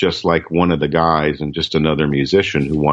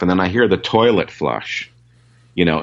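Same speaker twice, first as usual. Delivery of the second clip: ordinary delivery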